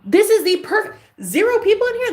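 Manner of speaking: light up with the realisation; excited about zero viewers. Real, not acted.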